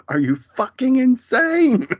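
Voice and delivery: high, loud imitation of her outburst; mock scream, pitch shooting way up; screaming in disbelief. Co-host quoting her screaming at him, outraged mock scream. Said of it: "I do love her screaming at him".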